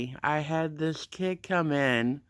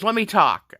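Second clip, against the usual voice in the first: raised, higher voice cutting in; retold sharp interjection; 6 semitones higher, 6 dB louder than usual. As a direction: imitate someone loudly demanding the floor. Guard cuts into heated argument.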